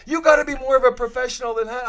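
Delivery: hammed-up outraged lecture; imitating scolding Gretz. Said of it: "Gretz is like".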